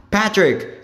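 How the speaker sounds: high, loud shout of a name; scream. A narrator's reading. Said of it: "screamed SpongeBob".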